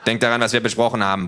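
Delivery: ordinary delivery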